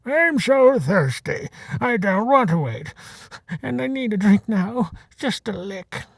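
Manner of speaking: put-on old bull begging to drink; Elmore's plea. A narrator's reading. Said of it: "Elmore bellowed".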